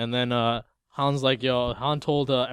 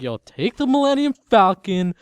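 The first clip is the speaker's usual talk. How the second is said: higher, louder mock plea; lightly voiced pilot handing over his ship, raised pitch; hammed-up Han Solo; 9 semitones higher, 7 dB louder than usual. Retold order to a pilot.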